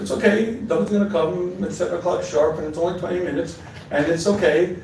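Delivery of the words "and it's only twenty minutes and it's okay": ordinary talk, nothing marked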